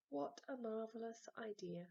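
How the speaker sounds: ordinary delivery, nothing marked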